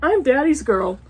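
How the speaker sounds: sing-song picture book reading; reading to children. Acted. Reading an old picture book aloud to her viewers, storytime voice. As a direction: read it like a storytime page.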